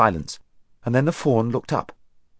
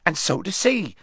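cried out; 8 semitones higher than usual. A narrator's reading. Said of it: "cried Tumnus".

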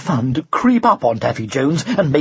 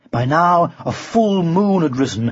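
ordinary delivery, nothing marked

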